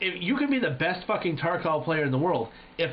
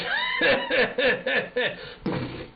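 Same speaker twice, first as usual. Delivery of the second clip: mocking laugh; 12 semitones higher than usual. Enemy gloating after killing him in Call of Duty, mocking laugh. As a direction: cackle like the winner. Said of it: "he'd be like".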